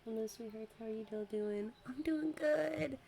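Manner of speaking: ordinary talk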